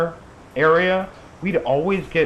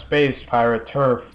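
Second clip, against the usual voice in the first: ordinary delivery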